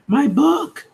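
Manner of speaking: high-pitched acted outcry; upset exclamation. Character whose book is being eaten, high upset exclamation.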